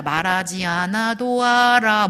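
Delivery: very slow, stretched-out recital; mocked assumption. Speaker quoting a common misconception, slow mocking singsong. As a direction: draw each syllable out in a teasing singsong. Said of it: "이런 착각들을 합니다".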